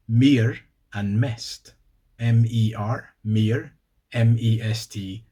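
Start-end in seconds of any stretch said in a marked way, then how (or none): 0.1-1.7 mer and mest said very slowly, the Swedish way. Shown as a demonstration. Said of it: "In Swedish".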